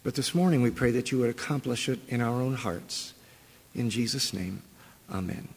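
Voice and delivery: soft, reverent prayer close; closing prayer. Real, not acted. Pastor closing the prayer before the sermon, reverent and soft. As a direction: hush into the amen.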